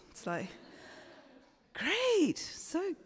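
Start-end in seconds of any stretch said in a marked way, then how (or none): 1.7-2.9 very high, strained fake enthusiasm; sarcastic high-pitched "happy" reaction; mock delight. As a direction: pretend to be thrilled about unwelcome guests. Mock delight at unwelcome guests.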